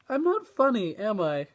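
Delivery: high, loud comic whine; hammed-up self-doubt, pitched high; mock despair. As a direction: blurt it as a self-mocking lament. Self-mocking outburst after failed joke.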